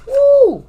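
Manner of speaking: high, loud "woo"; excited whoop. Real, not acted. Reader cheering on the viewers, high whoop. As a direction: let out a loud celebratory whoop. Cheers that they have got this.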